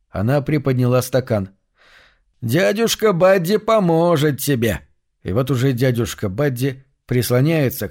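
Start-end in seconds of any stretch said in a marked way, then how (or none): 2.4-4.8 slow, higher coaxing man; coaxing uncle voice. Acted. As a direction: sweet-talk her into drinking. Buddy coaxing the girl.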